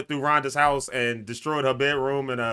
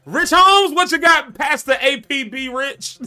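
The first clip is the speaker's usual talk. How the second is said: high, loud hype introduction; calling out; 11 semitones higher, 6 dB louder, slower than usual. Real, not acted. Host calling on Pastor Rich, high loud hype call. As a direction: announce him like a hype MC. Host calls on next guest.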